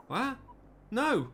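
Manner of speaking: shocked gasp. Acted. Mother learns her son died, shocked disbelief. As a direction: caught off guard by terrible news. Mother hears of son's death.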